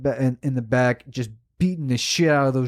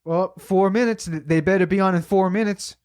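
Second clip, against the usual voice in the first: higher, whiny mock of her countdown; mocking impatient woman; 6 semitones higher than usual. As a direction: mimic her nagging about the clock, pitched up.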